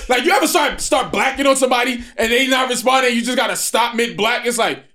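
high, loud mock rant cut short. Explaining a rant that fizzles, high loud mock rant. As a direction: act out blacking on someone. Acting out a mid-rant stop.

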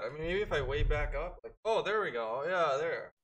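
distant, off-mic voice; speaking from far back. Real, not acted. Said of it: "it sounds like you're far away".

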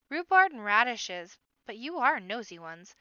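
booming mock outburst at the bunnies; loud exclamation. The bard booming at the nosy rabbits, loud.

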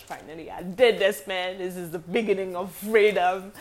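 loud, triumphant burst of relief; elated relief. Retold or imitated. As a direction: belt it out like freedom at last. Retold relief at graduation.

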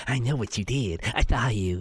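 low, creepy gardener voice; low-pitched, creepy impression of a gardener. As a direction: drop down low like a creep who knows a secret. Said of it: "creepy himself. He's like".

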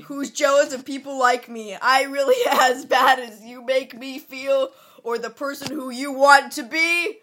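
loud dramatic poem recital; dramatic poem reading. Acted. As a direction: declaim it like a wounded teen. Reads poem aloud.

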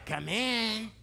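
put-on grandma voice from the wolf. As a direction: play the wolf posing as grandma. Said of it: "the wolf then disguises himself as grandma".

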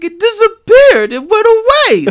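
hammed-up bawling child; imitated crying kid. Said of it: "he just starts crying".

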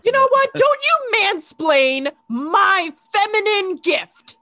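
huffy, high and slow; mock indignation. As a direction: play offended diva. Mock outrage at mansplaining.

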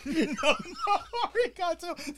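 slow, high, laughing through the words; laughing repeat. Real, not acted. Savoring cohost's pun, slow high laughing repeat. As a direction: stretch the pun out while cracking up.

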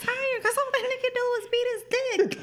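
high laughing put-on voice; high mock voice. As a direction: keep the squeaky, giggling storytelling voice.

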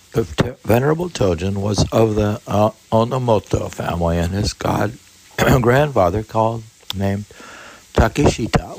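high, loud, brisk book reading; formal reading aloud. Acted. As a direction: read the passage out like a formal text. Reads the biography aloud.

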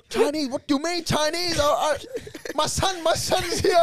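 high, frantic comic impersonation; Wog dad from Super Wog. Acted. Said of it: "like the Wog dad in Super Wog".

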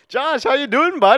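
put-on loud, high hockey-bro Canadian accent. Imitating coworker on the phone, loud Canadian hockey accent. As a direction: holler it as an exaggerated Canadian buddy.

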